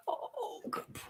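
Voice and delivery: hushed whisper to a dog; soft pet praise. Real, not acted. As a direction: murmur fondly to the pet.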